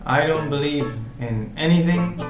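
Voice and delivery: usual speaking voice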